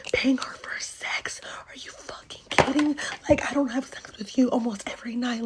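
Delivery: hushed, strained mock rant; whispered mock yelling. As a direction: act out screaming under your breath. Acted confrontation over paying for sex.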